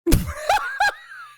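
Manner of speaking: high, piercing burst of laughter; shrieking laugh. Real, not acted. Laughing at the clip, a high shriek.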